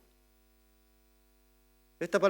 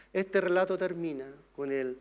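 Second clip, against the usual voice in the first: usual speaking voice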